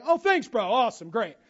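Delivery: sarcastic bro, oh thanks, awesome. Acted. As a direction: fake gratitude, hammed-up bro voice. Sarcastic reply acted out.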